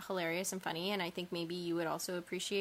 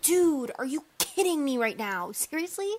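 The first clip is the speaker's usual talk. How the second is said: louder, higher hammed-up disbelief; mock outrage; 6 semitones higher, 7 dB louder than usual. Reacting to the boyfriend bending pipes by hand, mock outrage. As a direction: yell your fake shock at him. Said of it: "you're overdoing it".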